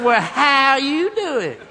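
loud, high, drawn-out howdy; exaggerated slow singsong hello; mimicked drawling greeting. Mimics the lady's greeting back.

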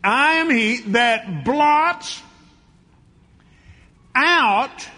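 loud, high, slow preaching; full-force pulpit proclamation. Acted. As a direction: drive each word home to the church. Preaching the verse again.

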